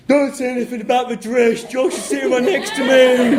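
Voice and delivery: high, loud shriek imitating the bride; mock scream down phone. Groom mimics his bride warning mum by phone, high mock scream. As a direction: scream it shrilly high, as a put-on impression. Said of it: "Angela will scream down the phone".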